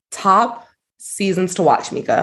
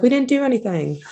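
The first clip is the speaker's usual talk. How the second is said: put-on higher, barely-there Australian accent; attempted Australian accent; 8 semitones higher than usual. Retelling his denial to cohost, attempted Australian accent. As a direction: have a loose go at an Australian accent. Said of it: "That was my Australian accent".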